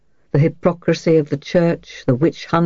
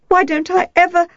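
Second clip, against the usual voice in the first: put-on much higher girl's voice, cut off mid-cry; breaking off; 13 semitones higher than usual. A narrator's reading. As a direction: she stops herself before crying. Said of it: "She broke off with an effort".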